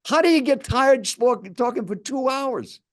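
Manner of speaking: high, forceful retold challenge; high-pitched, boastful quoted protest; scoffing disbelief. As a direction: imitate a pushy stranger who can't believe it. Stranger scoffs at his tiredness.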